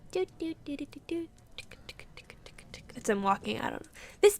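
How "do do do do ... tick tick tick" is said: whispered ticking. Acted. Mimics walking, whispered.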